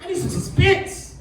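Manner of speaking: high, loud whoa whoa wait; mock alarm. Stopping his date mid-flirt, high and alarmed. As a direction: stop her in a mock panic. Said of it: "I was like".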